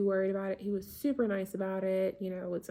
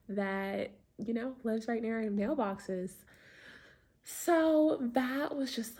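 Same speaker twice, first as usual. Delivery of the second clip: ordinary delivery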